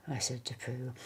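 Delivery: ordinary delivery, nothing marked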